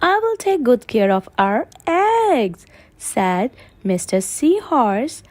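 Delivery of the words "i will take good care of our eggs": character voice. Acted. Mr Seahorse promises, high.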